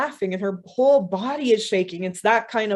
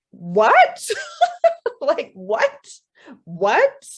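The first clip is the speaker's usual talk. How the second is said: loud, rising one-word questions broken by laughter; baffled disbelief; 8 semitones higher, 6 dB louder, much slower than usual. Acted. Woman shaking her head at the crow, laughing disbelief. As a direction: laugh helplessly between stunned questions.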